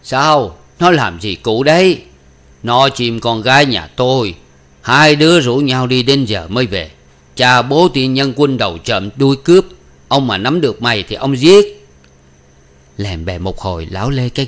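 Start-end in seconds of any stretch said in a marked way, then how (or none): 0.0-2.0 loud, higher question called out; raised voice asking from indoors; amused calling out. A narrator's reading. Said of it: "bật cười hỏi vọng ra". 2.6-11.9 loud, higher-pitched furious rant; outraged father's loud threats; angry cursing. A narrator's reading. Furious father curses daughter's lover.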